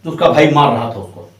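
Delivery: normal talking voice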